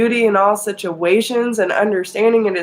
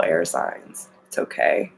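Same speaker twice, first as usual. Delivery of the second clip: whispered, much softer reassurance; 14 semitones lower, 6 dB quieter than usual. Real, not acted. Reader comforting air signs, whispered softly. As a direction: breathe it out gently to comfort.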